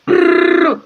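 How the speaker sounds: loud high rattling brrr; mouth-made gunfire; gunfire imitation. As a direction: rattle out a burst of shots.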